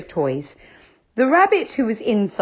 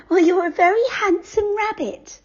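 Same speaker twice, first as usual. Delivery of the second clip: very high sweet doll flattering; a doll paying a shy compliment; Silky, high and sweet. A narrator's reading.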